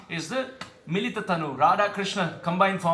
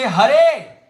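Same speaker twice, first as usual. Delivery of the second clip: Hare sung out louder; called-out Hare; 3 semitones higher, 9 dB louder than usual. Shown as a demonstration. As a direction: call "Hare" like a chant. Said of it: "When you say".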